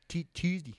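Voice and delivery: Tuesday with a stuttered T and choose-dee; odd Tuesday. Retold or imitated. Imitating a customer's strange Tuesday, choose-dee. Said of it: "It was like".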